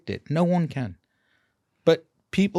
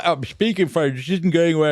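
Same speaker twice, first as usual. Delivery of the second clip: higher, louder mock complaint of a fretting patient; lightly voiced nagging patient; imitating a whiny patient; 4 semitones higher, 5 dB louder than usual. Dentist mimicking the patient who keeps moaning, mild mock whine.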